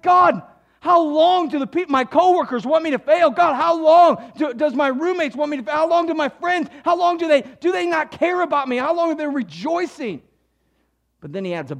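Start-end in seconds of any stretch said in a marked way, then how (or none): 0.0-10.2 loud, higher complaint to God about coworkers; voiced frustrated believer pleading; modern lament. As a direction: cry out, fed up and hurt. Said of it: "This is like a scene from work".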